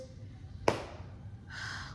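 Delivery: long breathy sigh; heavy sigh. Real, not acted. Girl venting to viewers about her friend, heavy sigh. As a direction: let out a long exhale.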